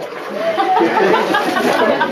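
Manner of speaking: a burst of breathy laughter; hearty laugh. Real, not acted. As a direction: laugh out loud at your own joke. Guide laughs at own joke.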